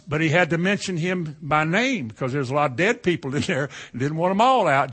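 ordinary talk, nothing marked

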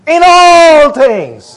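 loud, high, drawn-out rallying cry; emphatic preacher's shout; meaning it. Shown as a demonstration. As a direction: belt it out slowly like you mean it. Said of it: "let's mean it".

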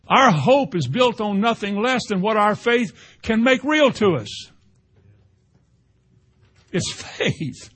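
high, loud, building sermon cadence; fiery preaching. Acted. Preacher rousing the church, rising loud fervent preaching. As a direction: preach it out to the congregation.

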